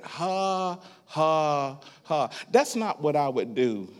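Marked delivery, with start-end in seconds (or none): 0.0-2.3 slow drawn-out spoken "ha"s; deliberate forced laugh. Retold or imitated. Preacher retelling laughter under the covers, dry forced laugh. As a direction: imitate laughing without feeling it. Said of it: "and I just started".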